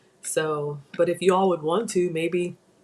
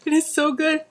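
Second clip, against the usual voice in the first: high delighted squeal with laugh; delighted exclaim; 9 semitones higher than usual. Real, not acted. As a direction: burst out high and giddy. Tasting, whispered then exclaimed.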